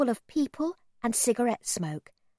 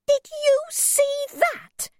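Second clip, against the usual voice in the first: high, loud and slow outraged cry; shocked shriek; 5 dB louder, much slower than usual. A narrator's reading. Mother shrieks to her son as the man steals an umbrella. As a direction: shriek it in disbelief. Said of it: "my mother shrieked".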